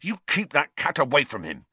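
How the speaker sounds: hot-tempered warning; furious. A narrator's reading. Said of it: "he said furiously to Hermione".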